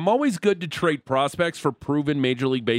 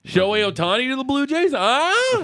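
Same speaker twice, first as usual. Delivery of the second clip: high, hopeful "huh?"; teasing excitement; 11 semitones higher than usual. Pretend. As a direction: pitch climbing to a high squeak. Mock excitement over trade rumours.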